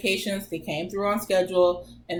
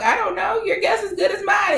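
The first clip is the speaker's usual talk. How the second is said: much higher, louder, baffled cry; shocked high shout; 14 semitones higher, 5 dB louder than usual. Retold or imitated. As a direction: throw up hands in high-pitched disbelief. Retelling confusion to nurse, shouting.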